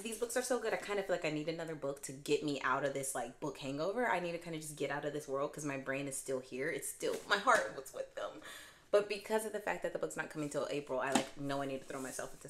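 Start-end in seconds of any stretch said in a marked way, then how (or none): none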